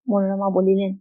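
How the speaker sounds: usual speaking voice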